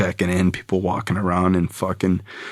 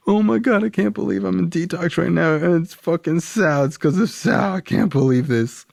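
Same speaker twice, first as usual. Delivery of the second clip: panicked despair; 11 semitones higher than usual. Retold or imitated. Despair at being in detox.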